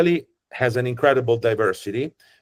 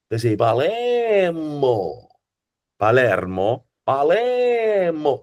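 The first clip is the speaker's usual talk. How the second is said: singsong Palermo Sicilian accent, much higher, slow and swooping; 6 semitones higher, much slower than usual. Shown as a demonstration. Guide demonstrating the melodic Palermo accent, singsong and drawn out. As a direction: chant it up and down like a Palermo street vendor. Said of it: "Western Sicilian of Palermo sounds like this".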